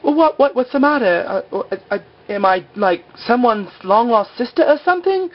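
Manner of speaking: very high, stammering put-on voice; mock flustered. Client questions the freaked-out psychic, high mock fluster. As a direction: imitate a flustered woman, voice shooting up.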